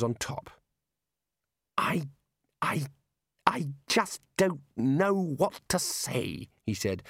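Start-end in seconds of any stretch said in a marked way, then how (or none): 1.8-6.5 halting, slow, high-pitched stammer; speechless dismay. Acted. Young wizard aghast at his colleagues, stammering dismay. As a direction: struggle to get each word out. Ponder upset, lost for words.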